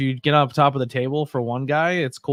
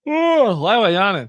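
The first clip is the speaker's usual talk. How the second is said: usual speaking voice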